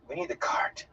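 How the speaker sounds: hushed, half-whispered reassurance; gentle. A narrator's reading. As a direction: say it softly, close to a whisper. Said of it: "he said gently".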